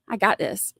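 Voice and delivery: usual speaking voice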